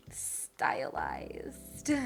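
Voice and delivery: breathy unvoiced tease; whispered. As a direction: whisper it as a sly aside. Whispered aside.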